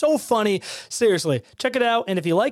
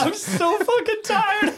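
much higher and slow, breaking into laughter; laughing; 12 semitones higher, much slower than usual. Real, not acted. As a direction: push it out through helpless laughter. Laughing fit, much higher, slowed.

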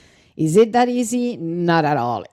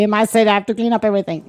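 quick mock quote; 2 semitones higher, much faster than usual.

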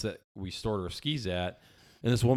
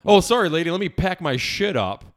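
loud, high sarcastic outburst; indignant sarcasm, raised voice; mock apology; 8 semitones higher, 8 dB louder than usual. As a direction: heap scorn on a fake apology. Sarcastic reply to woman.

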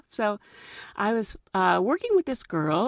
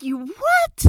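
shocked disbelief; 12 semitones higher than usual. Retold or imitated. Shocked reaction quoted.

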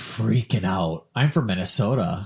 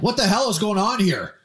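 much higher and louder gruff chief voice; fire chief demanding; 12 semitones higher, 4 dB louder than usual. Retold or imitated. Fire chief entering crowded hotel room, loud demand. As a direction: burst in and demand answers.

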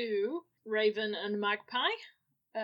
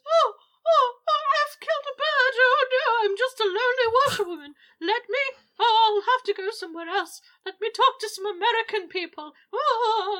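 high, loud wailing ghost lament; 13 semitones higher, 9 dB louder than usual. Acted. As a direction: wail it out like a distraught ghost. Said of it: "It's a very good ghost impression".

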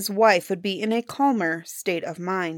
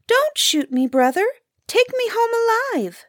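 high little bird voice, begging; pleading grouse; 14 semitones higher than usual. A narrator's reading. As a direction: plead for your life as a small bird, pitched way up. Grouse begs the hunter again.

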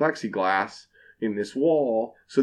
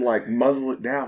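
usual speaking voice

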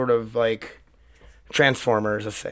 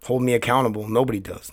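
normal talking voice

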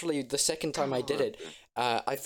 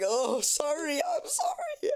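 mock crying apology; 13 semitones higher, slower than usual. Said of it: "And I was like".

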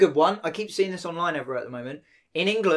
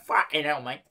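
put-on Cockney accent. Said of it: "I didn't do Cockney".

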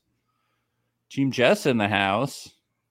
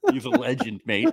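hammed-up British accent, praising; lightly British bloke voice; mock British accent; 2 semitones higher than usual. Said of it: "Harry Jowsey accent".

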